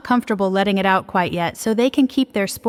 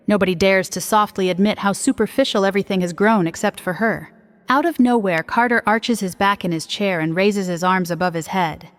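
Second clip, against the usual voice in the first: ordinary delivery, nothing marked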